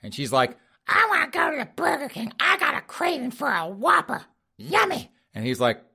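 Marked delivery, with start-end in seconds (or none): 0.9-5.1 high cartoonish old lady craving a burger; falsetto old wife. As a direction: squeak it out like a greedy granny. Wife voiced craving Burger King.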